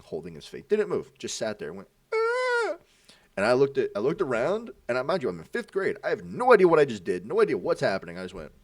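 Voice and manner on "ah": high, held mock wail; imitated crying wail. Storyteller imitating a slapped kid, high mock crying. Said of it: "He starts crying".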